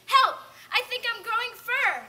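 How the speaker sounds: loud, high cry for help; theatrical alarmed yell; panicked scream. Acted. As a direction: scream as if your body is changing.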